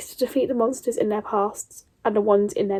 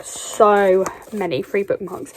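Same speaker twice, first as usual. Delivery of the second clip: ordinary talk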